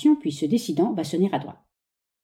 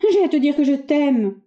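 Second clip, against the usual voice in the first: high, louder gush of love; effusive; 6 semitones higher, 4 dB louder than usual. Acted. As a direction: throw her heart open. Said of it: "Avec expansion".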